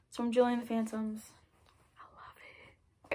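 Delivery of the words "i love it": slow whispered love it; soft, slow whispered confession. Real, not acted. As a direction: breathe it out like a secret. Whispered after showing the shirt.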